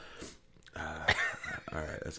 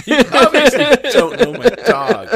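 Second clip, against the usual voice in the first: much higher and louder, slowed, laughing; shrill mock yell; 14 semitones higher, 15 dB louder than usual. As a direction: scream it hysterically. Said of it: "she probably just yells".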